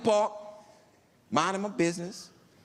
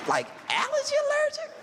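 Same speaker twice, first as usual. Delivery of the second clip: much higher, incredulous squeak; stunned high-pitched disbelief; shocked. Retold or imitated. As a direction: disbelief shooting up. Shocked at allergy news.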